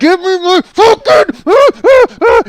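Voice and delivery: high, screamed demand breaking into yells; Hulk smash rage. Retold or imitated. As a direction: roar it slowly like a raging Hulk. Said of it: "just like the Hulk smash".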